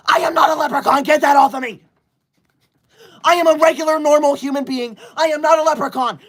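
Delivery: protesting the leprechaun hat to chat, mock indignant.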